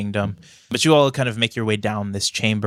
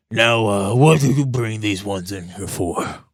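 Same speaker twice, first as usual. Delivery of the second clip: grumpy dwarf king. Acted. GM voices the king.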